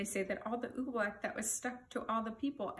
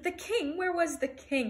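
higher-pitched gasp of alarm; 6 semitones higher, 4 dB louder than usual. A narrator's reading. Bartholomew remembers the forgotten king, gasped alarm. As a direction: exclaim it in sudden alarm. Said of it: "Bartholomew gasped".

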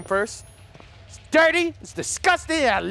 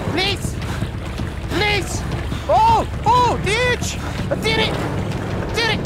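desperate excited yelling; 9 semitones higher, slower than usual. Real, not acted. Escaping the monster.

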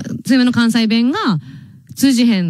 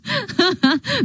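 high rising giggle; 9 semitones higher, 3 dB quieter than usual. Real, not acted. Surprised by a passerby.

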